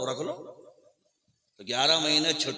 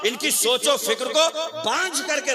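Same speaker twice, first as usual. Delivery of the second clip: raised, emphatic exhortation; full-force preaching; 11 semitones higher, 4 dB louder than usual. Real, not acted. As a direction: drive the point home high and loud.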